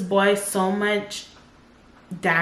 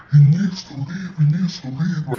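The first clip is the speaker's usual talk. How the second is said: lower, louder put-on voice of the boyfriend; angry boyfriend; 3 semitones lower, 5 dB louder than usual. Boyfriend demanding the son be thrown out, low loud voice. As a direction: bark it out like the furious boyfriend. Boyfriend cussing during the fight.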